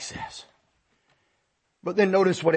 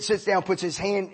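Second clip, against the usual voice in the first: usual speaking voice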